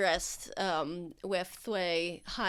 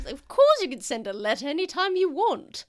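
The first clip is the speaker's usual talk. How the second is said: higher, grand noble lady; 6 semitones higher, 4 dB louder than usual. Acted. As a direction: promise it with a knowing wink. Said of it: "Lady Gwynth voiced".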